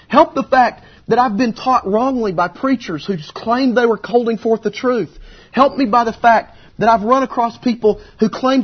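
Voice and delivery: high, loud, pleading preacher; impassioned plea. Acted. Wounded believer begging Jesus for help, impassioned. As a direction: build a driving, repeated cry for help. Acts the father's desperate plea.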